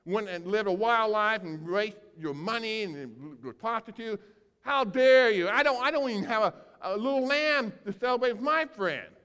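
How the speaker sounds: put-on older son accusing his father, furious; angry outburst. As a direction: rage at the unfairness.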